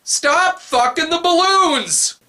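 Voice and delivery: high, drawn-out outraged shout; scolding high-pitched holler; exasperated yell. Acted. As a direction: yell it slowly at the screen, pitch way up.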